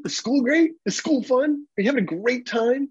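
put-on very high, eager questions to a child; grinning fake enthusiasm. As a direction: gush at the kid with a big dumb grin. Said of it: "big dumb grin on your face".

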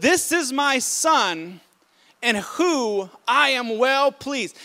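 loud, slow proclamation of God's voice; slow, loud and declaring; proclaimed, booming. Shown as a demonstration. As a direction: boom it out slowly like a voice from heaven.

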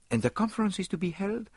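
Poirot voice. A narrator's reading. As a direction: weigh the question seriously. Poirot asking about conference.

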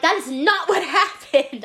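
high, emphatic protest breaking into a laugh; mock indignation. Clerk reacting to a lying review, mock outrage.

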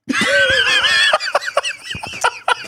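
long high-pitched shriek of laughter. Real, not acted. Roaring at the jail revelation.

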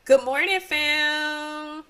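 high, loud good morning shout; loud greeting. Real, not acted. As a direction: call out the greeting.

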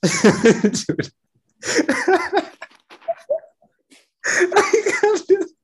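laughing too hard to talk; high giggling, can't hold it; cracking up. Real, not acted. Breaking character, cracking up.

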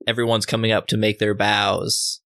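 ordinary talk, nothing marked

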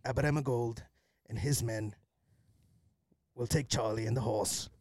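put-on low-key Birmingham accent, long pauses; measured Brummie gangster, halting; Thomas Shelby. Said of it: "trying to channel".

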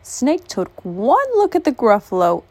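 ordinary delivery

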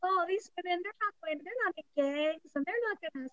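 high-pitched mock-innocent voice; mocking naivety. Mocking people who deny women's violence.

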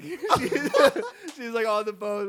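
high, loud laughter through words; cracking up retelling the story; laughing hard. Real, not acted.

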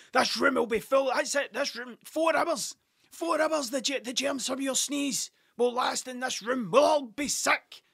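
teacher screaming at a pupil over a sneeze, mock fury; ballistic teacher. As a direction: ham up a furious teacher yelling about germs. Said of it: "He went absolutely fucking ballistic".

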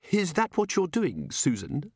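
high, anxious employer's voice; fretful lady of the house. A narrator's reading. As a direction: ask nervously, worried about the answer. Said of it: "said Missus Gator anxiously".

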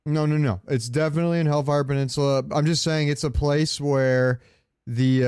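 ordinary talk, nothing marked

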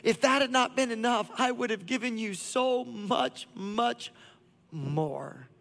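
the Father longing over what could have been; God's longing. Acted. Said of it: "you hear the heart of God".

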